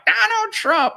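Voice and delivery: very high, loud mocking impression; mocking Hillary Clinton. Imitating her aggravating voice, high mocking squawk. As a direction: squawk the name in mockery of her. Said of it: "I was mocking her".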